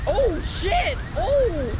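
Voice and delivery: loud, high startled cry; jolted by a sudden scare; startled yelp. Real, not acted. Sudden danger in game.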